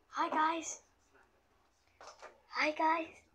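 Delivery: high, bright-pitched cartoon greeting; high put-on hello; character voice. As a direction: pitch it up like a little cartoon racer. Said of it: "That's how I do the voice".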